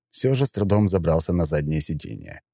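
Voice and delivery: ordinary delivery, nothing marked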